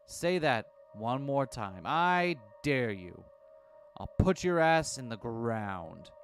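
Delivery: very slow, menacing threat. Acted. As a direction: spell out each word coldly. Susumu threatens Minorikawa.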